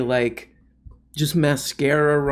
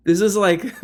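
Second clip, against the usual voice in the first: ordinary talk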